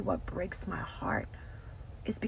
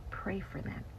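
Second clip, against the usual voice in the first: whispered plea; 7 dB quieter than usual. Real, not acted. Urging prayer, hushed then repeated.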